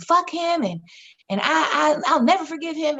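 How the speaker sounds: retold anger. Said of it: "my anger was directed at".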